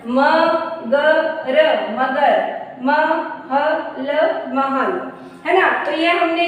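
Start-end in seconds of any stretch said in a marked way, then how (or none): 0.1-1.7 मगर slowly split into म, ग, र; sounded out. Shown as a demonstration. Teacher showing children how to join मगर, letter by letter. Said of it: "इस तरह से जोड़ के पढ़ेंगे". 2.8-4.1 महल slowly split into म, ह, ल; sounded out. Shown as a demonstration. Teacher showing children how to join महल, letter by letter. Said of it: "इस तरह से जोड़ के पढ़ेंगे".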